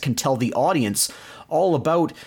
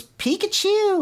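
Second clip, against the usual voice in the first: very high squeaky Pikachu cry; high cartoon creature squeak; bad Pikachu impression; slower than usual. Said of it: "the worst Pikachu impression in history".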